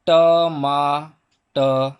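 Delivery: टमाटर broken into four slow syllables with gaps; ट, मा, ट, र, each sound held apart; sounded-out word. Shown as a demonstration. Teacher giving pupils a new word, टमाटर split sound by sound. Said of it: "एक शब्द मैं बताता हूँ आपको".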